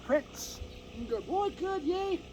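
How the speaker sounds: high, bright praise for the dog; happy dog praise. Real, not acted. Trainer praising his puppy, high and excited. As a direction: reward him with your voice.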